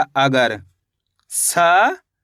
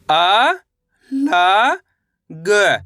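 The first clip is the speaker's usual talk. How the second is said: each letter drawn out, with a long pause after it; stretched, separated syllables; much slower than usual. Shown as a demonstration. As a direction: sound out the letters one at a time for learners. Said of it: "मेरे साथ साथ इनको repeat".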